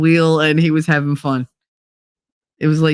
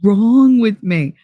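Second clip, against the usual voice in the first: slow, drawn-out lament; despairing; 3 semitones higher, 3 dB louder, much slower than usual. Retold or imitated. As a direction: stretch it out in mock despair. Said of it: "I was like".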